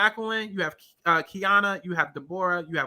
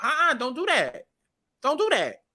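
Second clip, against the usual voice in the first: acted quote; 5 semitones higher than usual. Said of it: "pushed the girl back like".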